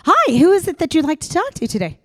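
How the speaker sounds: sweet switchboard pickup; office phone voice. Retold or imitated. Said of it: "same voice".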